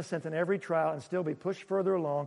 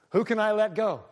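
mock president; 3 semitones higher, 3 dB louder than usual. Imitating a president.

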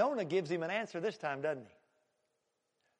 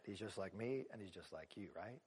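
hushed confession; 11 semitones lower, 18 dB quieter than usual. Real, not acted. Preacher softly admitting to the congregation, hushed. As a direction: drop almost to a murmur, close and personal.

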